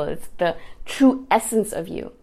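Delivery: usual speaking voice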